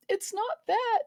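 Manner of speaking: high, squeezed tearful reply; trying not to cry. Retold or imitated. Nurse answering an apologetic patient, imitated near-tears. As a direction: imitate someone holding back tears. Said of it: "trying not to cry".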